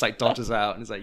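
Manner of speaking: normal talking voice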